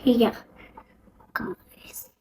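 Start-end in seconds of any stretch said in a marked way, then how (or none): 1.3-2.1 breathy whispered call to the viewers. Real, not acted. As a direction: lean in and whisper it.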